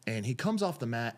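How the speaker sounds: usual speaking voice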